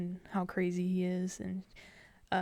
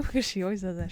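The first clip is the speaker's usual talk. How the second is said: ordinary talk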